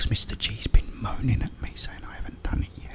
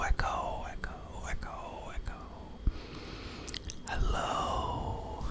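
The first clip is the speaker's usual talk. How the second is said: whispered echo moving from ear to ear and fading; ASMR trigger words; 5 dB quieter, much slower than usual. Acted. Whispering trigger words for viewers on headphones, panned fading whisper. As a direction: drift each echo further off and softer. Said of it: "Really need your cans on".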